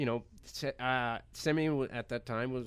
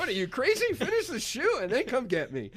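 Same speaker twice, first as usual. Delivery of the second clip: higher and louder, playfully incredulous scolding; mock exasperation; 13 semitones higher, 7 dB louder than usual. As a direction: tell your teammates off as if the answer were obvious. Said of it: "She'd be like".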